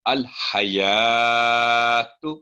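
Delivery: अल हयातु with no pause on the ल; slow recitation. Shown as a demonstration. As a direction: recite slowly, linking the ल. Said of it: "लाम पर जज़म कभी भी आपने ठहरना नहीं".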